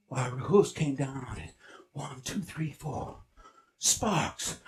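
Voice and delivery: soft, low storytelling; hushed suspenseful narration. Acted. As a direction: hold the leap in suspense. Hushed slow climax narration.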